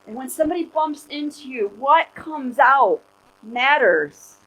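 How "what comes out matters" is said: very slow, louder, landing on "matters"; slow emphatic point. Real, not acted. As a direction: drive the lesson home word by word. Preacher's closing point.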